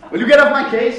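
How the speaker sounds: frustrated producer snapping down the phone; exasperated outburst. Acted. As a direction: blow up at the caller on the line.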